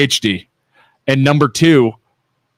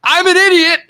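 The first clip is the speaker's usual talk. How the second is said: much higher, louder mock groveling apology; hammed-up pleading husband; 13 semitones higher, 5 dB louder than usual. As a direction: overact the sorry husband begging forgiveness. Voicing himself pleading to wife.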